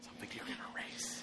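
hushed whisper; worried whisper. Real, not acted. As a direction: whisper it under your breath. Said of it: "Why are we whispering?".

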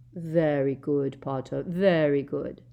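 slow, drawn-out praise; slowly. A narrator's reading. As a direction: savour each word slowly. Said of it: "said Malfoy slowly".

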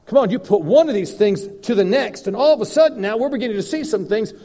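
high, loud preacher's build; preaching at full force. Real, not acted. Preacher urging congregation about what God can do, full-force preaching.

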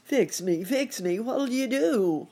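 high, loud alligator wail; hammed-up gator in pain; crying out. A narrator's reading. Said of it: "cried the alligator".

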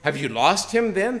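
mocking shout. A narrator's reading. Unbelievers in the marketplace mocking the madman, jeering. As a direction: taunt him like a scoffer. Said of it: "They shouted and laughed".

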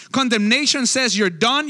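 normal talking voice

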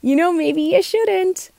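high, slow voice of self-doubt; doubting little voice. Retold or imitated. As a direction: whisper doubts like a nagging inner voice. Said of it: "that little voice comes up and says".

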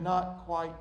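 soft, low, calm reply; smiling potter. Acted. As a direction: answer quietly with a patient smile. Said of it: "the potter smiled and said".

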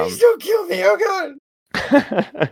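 terrified shrieking plea; panicked begging. Acted. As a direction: scream and beg for your life. Begging Space Marine for life.

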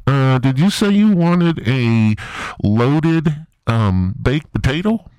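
high, loud, eager fast-food order-taker voice; Harold from Wendy's. Acted. Comic voices Wendy's worker Harold taking an order, high and loud. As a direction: chirp it like a too-polite drive-thru worker. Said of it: "this is Harold from Wendy's".

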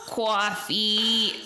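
long, called-out Northern "coffee"; mock yell in an up-north accent; nursing-home resident demanding it in Northern vowels; imitated Northern accent. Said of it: "she's just yelling at somebody".

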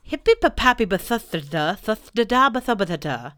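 loud mock-grand reading of gibberish; garbled reading. Owl reading his misspelt message, mock-grand. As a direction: read nonsense with full confidence.

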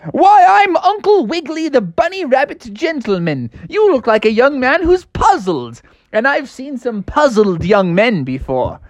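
high, genteel rabbit gentleman; bunny gentleman voice. Acted. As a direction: speak like a kindly dapper bunny. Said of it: "And the man said".

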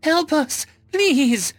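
very high, loud plea for help; high-pitched frantic begging; desperate wail. A narrator's reading. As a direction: cry out frantically, far up in pitch. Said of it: "a desperate voice wailed".

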